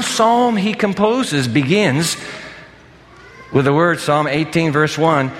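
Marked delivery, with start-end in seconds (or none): none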